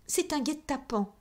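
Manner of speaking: sharp, high outraged cry. A narrator's reading. Caught countess cries out as the men return, high outraged cry. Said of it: "cria-t-elle".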